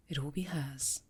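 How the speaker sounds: a quiet, menacing aside; ominous murmur. Acted. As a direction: drop to a low, soft sinister murmur. Ominous remark to pupil.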